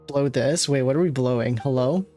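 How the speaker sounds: usual speaking voice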